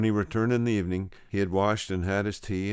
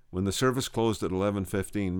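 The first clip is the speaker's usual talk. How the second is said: ordinary delivery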